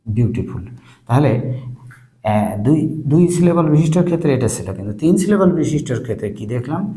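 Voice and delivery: usual speaking voice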